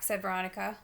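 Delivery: ordinary delivery, nothing marked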